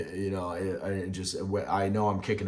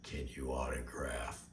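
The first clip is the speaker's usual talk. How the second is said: slow, softer whisper of the ask; mock whispered request; 8 semitones lower, 12 dB quieter, slower than usual. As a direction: whisper it like a timid fan.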